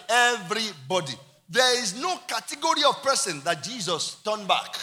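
loud, punched list, word by word; emphatic pulpit shout, key words drawn out; full-force preaching. Real, not acted. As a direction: thunder it out to the congregation.

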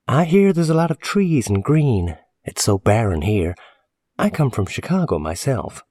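high-pitched woman's voice; chatty landlady. Acted. Landlady chatting to a new lodger about Oregon, high and chatty. Narrator voices Missus Appleton.

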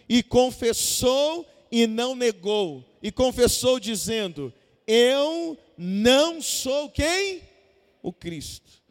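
slow, emphatic Bible reading with a held cue word; scripture reading. Acted. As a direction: read the verse deliberately, drawing out the key words. Reads scripture for congregation to complete.

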